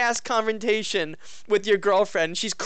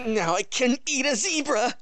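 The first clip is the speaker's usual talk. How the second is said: slow, higher goofy hero line; mock hero voice; 5 semitones higher than usual. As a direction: ham up a dumb hero boast. Mocking the game hero.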